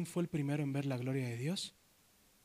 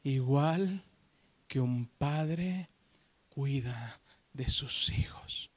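slow, lower, fading to a whisper; hushed reading; 5 semitones lower, much slower than usual. Acted. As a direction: breathe the verse out gently. Reading verse softly. (lectura susurrada, lenta y grave)

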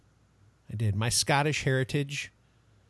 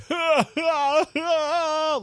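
loud high mock bawling; hammed-up wailing sobs; mock sobbing; 6 dB louder than usual. Shown as a demonstration. Host showing snot-bubble crying, exaggerated mock sobbing. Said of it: "like, snot bubbles".

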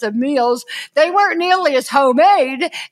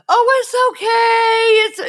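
mock dismissive; 10 semitones higher, 4 dB louder, slower than usual. Mocking a dismissive comforting phrase.

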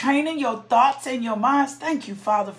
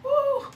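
joyful whoop. Real, not acted. Worship outburst.

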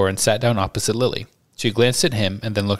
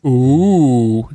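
louder, snooty mock of her tone; mocking lofty voice; 3 semitones higher, 7 dB louder than usual. Boys mocking the girl as she leaves, lofty mock voice. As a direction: ape her haughty air. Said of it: "James and Sirius imitated her lofty voice".

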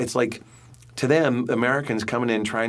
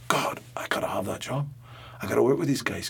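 put-on breathy whisper of longing in a light Geordie accent; hushed inner voice, lightly Geordie; 2 semitones higher, 3 dB quieter than usual. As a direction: whisper it like a wish you daren't say aloud. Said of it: "I came away from the first audition going".

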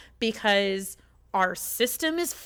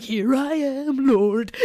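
slow, devout surrender with "Lord" louder; mock solemn prayer; 3 semitones higher, 5 dB louder, much slower than usual. Alone at 3 AM answering God, a slow mock-devout offering. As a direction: offer yourself up like a hymn, slowly. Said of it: "of just like".

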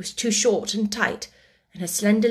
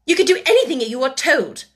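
fast, loud, raised command; stern answer; 5 semitones higher, 7 dB louder, faster than usual. A narrator's reading. Headmistress tells the child to obey, sternly. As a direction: answer curtly and firmly.

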